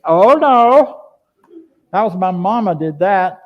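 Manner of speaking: loud, high hammed-up denial; theatrical high-pitched protest; mock protest. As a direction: cry out in exaggerated objection. Mock protest, blaming parents for experience.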